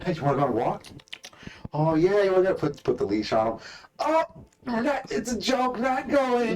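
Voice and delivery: put-on high, loud sing-song baby talk; teasing dog owner. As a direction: tease the dog cheerfully.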